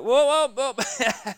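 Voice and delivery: high, loud, alarmed whoa; startled whoa. Retold or imitated. Preacher imagines being told to heal the sick, mock startled. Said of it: "I'd have been like".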